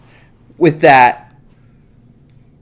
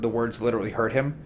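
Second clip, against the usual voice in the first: ordinary talk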